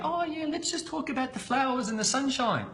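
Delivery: lightly hammed-up nice guy chatting about flowers; mock sweet talk. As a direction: put on a prim, sweet little voice to mock boring talk. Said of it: "if I sit there and go".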